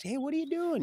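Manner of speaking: high mock call across the yard. Roy calling to neighbor throwing apples, a light mock call. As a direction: retell hailing him from afar.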